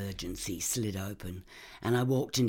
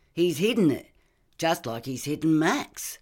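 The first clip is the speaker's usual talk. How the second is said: angry insistence; 6 semitones higher, 6 dB louder than usual. Acted. Partner insists suspect hid boy.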